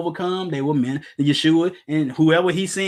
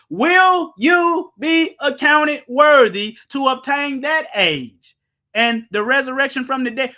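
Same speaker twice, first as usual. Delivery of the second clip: 1 preaching shout; 7 semitones higher, 6 dB louder, slower than usual. Real, not acted.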